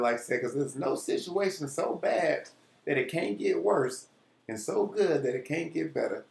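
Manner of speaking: ordinary talk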